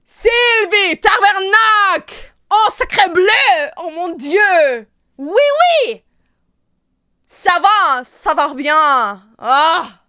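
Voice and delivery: loud, high, hammed-up Canadian French; over-the-top Quebecois French shouting; Canadian French accent. Shown as a demonstration. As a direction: exclaim like a mock Quebecois, drawn out and shouted. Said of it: "Canadian French?".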